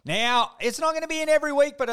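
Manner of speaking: high, loud segment announcement; announcer. Acted. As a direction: announce it like a game show.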